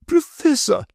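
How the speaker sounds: high, loud lady's call, sudden. A narrator's reading. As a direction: bark his name out suddenly as the grand lady. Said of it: "so loudly and suddenly".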